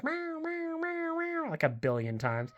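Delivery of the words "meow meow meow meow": high, soft imitated meows; cat meowing. Owner imitating her cat meowing over and over, high and soft. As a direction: mimic a cat meowing nonstop. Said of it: "He goes".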